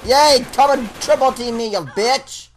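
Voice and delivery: sarcastic taunt at the enemies; sarcastic cheer. Real, not acted. Sarcastic cheer at enemy team.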